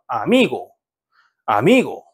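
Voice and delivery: 아미고 with the stress on 미; stress on second-last syllable. Shown as a demonstration. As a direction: punch the middle 미 in 아미고. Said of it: "뒤에서 두 번째 음절에 강세 있고요".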